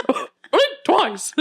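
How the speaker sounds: high cartoon mouse voice with a laugh; redoing the Mickey voice on request, laughing. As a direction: squeak out the line like Mickey. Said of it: "That sounded like Mickey".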